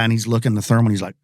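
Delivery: ordinary delivery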